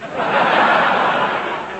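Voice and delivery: a burst of breathy laughter; hearty laugh. Real, not acted. Comic laughing at his own joke, hearty laugh. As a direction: laugh at your own gag.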